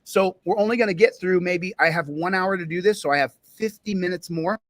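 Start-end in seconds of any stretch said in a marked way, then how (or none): none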